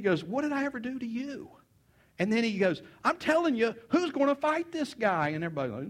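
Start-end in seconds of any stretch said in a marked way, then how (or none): 0.2-1.5 high, softer mock protest; protesting little brother. 3.0-5.3 high-pitched, eager acted demand; insistent young challenger. David pressing soldiers for a fighter.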